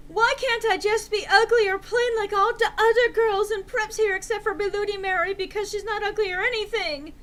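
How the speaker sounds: high, loud whining complaint; whiny rant. Acted. Enderby complaining to Draco, high loud whine. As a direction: whine it high like a spoiled teen.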